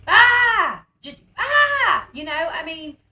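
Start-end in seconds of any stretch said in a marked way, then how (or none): 0.1-0.9 loud, high mock scream; imitated yell. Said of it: "And then she just yells". 1.4-2.1 very high, loud mock scream; imitated yell. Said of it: "And then she just yells".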